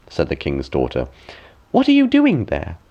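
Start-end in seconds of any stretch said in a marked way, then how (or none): 1.7-2.8 put-on much higher, louder girlish question; a young princess calling out curiously. Princess asks old woman.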